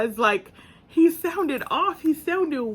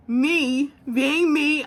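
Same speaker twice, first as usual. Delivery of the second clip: ordinary delivery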